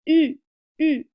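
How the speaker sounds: "U" with lips rounded and tongue forward; rounded front "U"; French u. Shown as a demonstration. Teacher modelling the French u. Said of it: "you're gonna be making the U sound".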